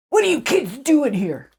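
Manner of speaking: lightly voiced stern grandpa scolding; stern caretaker. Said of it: "but he was being stern".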